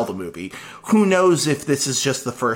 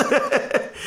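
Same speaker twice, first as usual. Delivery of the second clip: maniacal villain cackle, breathy and high; evil chuckle; 12 semitones higher than usual. Acted. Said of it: "What an evil laugh".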